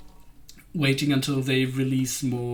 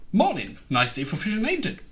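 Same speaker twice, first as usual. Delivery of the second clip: chirpy game villager with a high, swooping finish; NPC catchphrase; 5 semitones higher, faster than usual. Acted. Imitating an NPC who only has one phrase, sing-song greeting. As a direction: swing the pitch up on the tail question. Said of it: "he only has one phrase".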